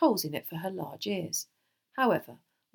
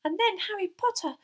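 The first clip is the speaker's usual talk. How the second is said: very high, gleeful elf voice; thrilled little house elf; delighted squeak; 3 dB louder than usual. A narrator's reading. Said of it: "said Dobby delightedly".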